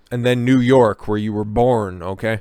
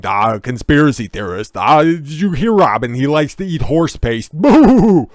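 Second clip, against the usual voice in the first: higher, louder mocking radio-host jeer; hammed-up jeering host, ending in a loud boo; mocking Stern impression; 3 semitones higher, 6 dB louder than usual. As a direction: sneer it like a smug shock jock taunting a guest. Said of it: "he went on a tirade of him being".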